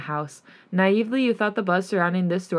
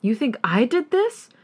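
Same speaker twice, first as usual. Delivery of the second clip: higher, offended disbelief; 6 semitones higher than usual. Acted. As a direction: throw the question back, stung. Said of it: "He's hugely offended".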